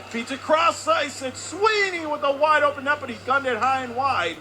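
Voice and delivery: high, loud scoring-chance call; excited play-by-play. Real, not acted. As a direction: cry out the wide-open chance.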